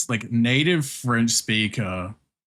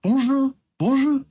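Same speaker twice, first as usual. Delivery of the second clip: put-on high, loud, French-accented bonjour; mock French bonjour; 4 dB louder than usual. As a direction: imitate a native French speaker repeating bonjour into a filter. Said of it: "a native French speaker, saying".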